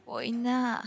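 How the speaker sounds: lightly acted groan; groaning dismay. A narrator's reading. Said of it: "نالید".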